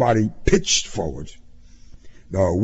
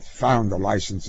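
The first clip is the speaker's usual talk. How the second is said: plain reading voice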